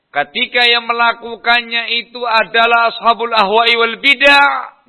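high-pitched, insistent preaching; impassioned preaching. Real, not acted. Preacher warns about people of innovation, impassioned preaching. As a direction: raise the pitch and drive the warning home.

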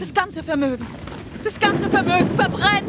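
high, loud, frantic calling out; desperate shouting. Acted. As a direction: shout to wake them and save the fortune. Anna cries out about the fire. (verzweifeltes, lautes Rufen)

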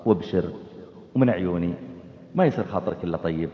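very low, soft, tender words; gentle loving speech. Shown as a demonstration. Son answering his parents gently, low and soft. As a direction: speak to your parents with tenderness. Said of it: "قولا كريما".

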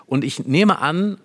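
ordinary talk, nothing marked

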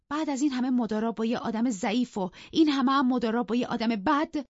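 high, loud hurt tirade; impassioned, high-pitched berating; bitter outrage. Acted. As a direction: build to a peak of betrayal, then curse him. Wife bitterly berates husband, curses him.

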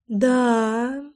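contented, stretched-out purr. A narrator's reading. Лой answers whether she is pleased, purring contentedly. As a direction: purr it lazily, with satisfaction. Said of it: "промурлыкала она".

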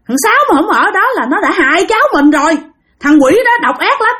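high, worked-up indignation; acted outrage, pressing each phrase; indignant account. Grandfather tells wife he stopped the attacker, indignant.

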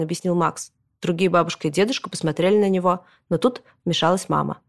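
usual speaking voice

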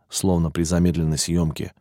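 ordinary delivery

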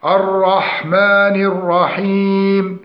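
slow, drawn-out الرحمن الرحيم; correct recitation. Shown as a demonstration. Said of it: "شرحنا كما سبق". (ترتيل تعليمي بطيء)

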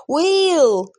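loud high yell of a name. Acted. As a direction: scream his name in alarm. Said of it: "заорала девушка".

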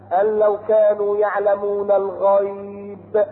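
slow chanted phrase; Quran recitation. Acted. Preacher chanting the verse about the jinn. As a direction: intone each syllable slowly.

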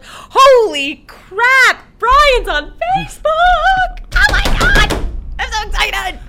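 squealing slow high fangirl gush; gushing excited fan. Retold or imitated. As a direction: swoon over it like a giddy fan. Fans gush over Brian joining.

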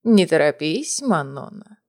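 put-on low cooing old woman; sweet old lady. A narrator's reading. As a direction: coo it softly like a doting granny. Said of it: "проворковала бабушка".

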